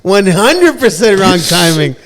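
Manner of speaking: high, loud and slow through laughter; laughing. Real, not acted. Laughing at the friend's terrible timing, slow and loud. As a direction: laugh out the words, drawing them out.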